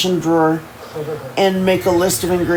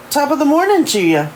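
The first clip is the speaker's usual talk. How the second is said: put-on playful Irish accent; 8 semitones higher than usual. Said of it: "she says in a playful Irish accent".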